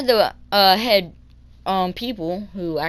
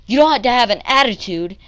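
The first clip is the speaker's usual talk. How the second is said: loud, higher mock reprimand; imitated scolding; 5 semitones higher, 7 dB louder, faster than usual. Bus driver scolding kid about attitude, loud imitated reprimand.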